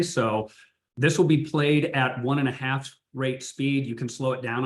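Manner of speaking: ordinary delivery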